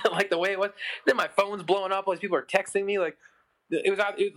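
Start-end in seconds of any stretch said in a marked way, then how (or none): none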